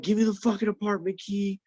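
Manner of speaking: high-pitched mock screech; imitated frantic demand. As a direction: mimic an ex losing her mind. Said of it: "And she's losing her mind".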